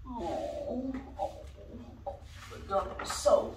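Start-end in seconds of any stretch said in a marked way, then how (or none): none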